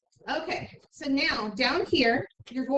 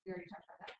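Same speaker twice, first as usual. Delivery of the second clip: whispered retort; 22 dB quieter, much faster than usual. Retold or imitated. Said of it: "I'm like".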